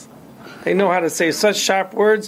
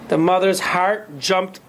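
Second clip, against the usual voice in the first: ordinary delivery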